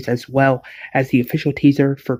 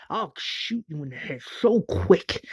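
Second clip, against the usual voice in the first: whispered threat, much softer; menacing whisper; 4 semitones higher, 7 dB quieter than usual. Acted. Tyrese threatening Rick at gunpoint, menacing whisper. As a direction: hiss the threat under your breath.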